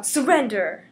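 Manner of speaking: a loud commanding call to yield. Acted. Scarlet Witch to the scientists, loud commanding order. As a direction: order it like a hero. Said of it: "She's all".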